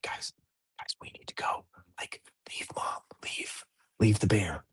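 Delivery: put-on urgent hushed whisper to the kids; mock whisper. Dad hushing the kids away from sleeping Mom, urgent whisper. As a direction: whisper so you don't wake Mom.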